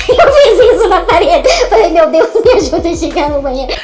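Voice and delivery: hammed-up drunken wail; loud laughing plea. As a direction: wail it, half laughing, desperate to get there. Drunk begging to reach bathroom.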